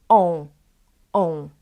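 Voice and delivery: "on" as one nasal O vowel. Shown as a demonstration. Teacher demonstrates the letter, nasal O. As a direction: sound "on" through the nose, no full n. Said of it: "This is nasal O".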